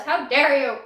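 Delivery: loud, laughing mock indignation; mock outrage. Teasing her ex who may watch, mock indignant and laughing. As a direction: scold him in jest, half laughing.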